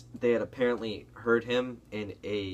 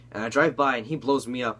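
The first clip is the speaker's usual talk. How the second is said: ordinary delivery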